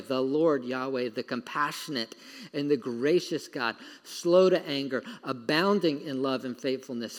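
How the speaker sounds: a touch of solemn scripture reading. Acted. Slow reverent reading.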